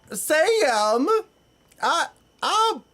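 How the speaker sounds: high, loud, hammed-up Southern accent; mock an over-the-top TV Southern accent, pushed high and loud; aggressive Southern accent. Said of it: "It's so aggressive".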